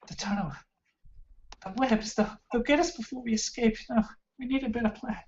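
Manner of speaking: high, breathy elf woman voice; frightened prisoner, pitched far up; anxious captive elf. Acted. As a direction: urgently warn in a high, hushed voice.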